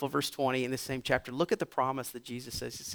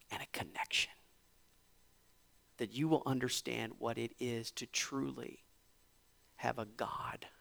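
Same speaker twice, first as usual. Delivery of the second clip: hushed preaching; 4 semitones lower, 8 dB quieter than usual. Acted. Hushed close of sermon.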